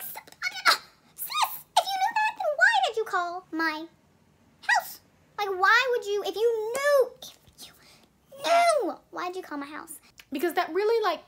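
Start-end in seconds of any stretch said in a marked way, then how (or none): 0.0-5.0 high, loud incredulous squeal at the counselor; mock outrage, pitched way up; squeaky indignant disbelief; exasperated retort. Confronting the guidance counselor. 5.4-9.9 incredulous retort. Retold or imitated. Confronting the guidance counselor.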